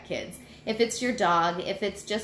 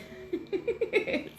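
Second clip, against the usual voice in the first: high breathless giggle; 13 semitones higher, 3 dB quieter than usual. Real, not acted. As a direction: break into an unvoiced giggle. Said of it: "I couldn't say that with a straight face".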